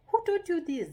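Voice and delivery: high, loud demand; mock astonished questioning. Shown as a demonstration. Parent questioning a child's funny new behaviour, mock astonishment. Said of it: "you are like".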